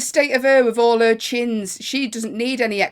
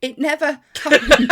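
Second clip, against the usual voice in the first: laughing; 6 semitones higher, 7 dB louder than usual. Real, not acted. Repeats denial laughing.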